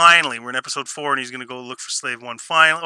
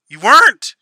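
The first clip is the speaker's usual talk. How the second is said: mock retort; 6 semitones higher, 9 dB louder than usual. Ranting back at the character.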